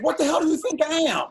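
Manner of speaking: high, loud, offended retort; indignant outburst. Retold or imitated. Telling the grant clerk off, loud and indignant. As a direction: fire it back at the clerk.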